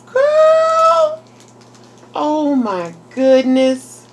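high, loud squeal, then slow gush; delighted shout. Real, not acted. Vlogger marvelling at her gift, delighted shout. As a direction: cry out in thrilled disbelief.

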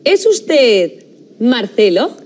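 ordinary talk

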